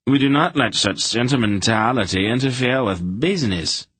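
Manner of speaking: lightly done high nasal whine; Albrig's high nasal voice. A narrator's reading. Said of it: "in his rather high-pitched nasal voice".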